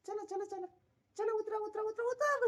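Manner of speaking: high, sing-song coaxing to a pet; coaxing cat. Real, not acted. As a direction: coax the cat down sweetly. (मांजराला खाली बोलावणारा उंच लाडिक आवाज)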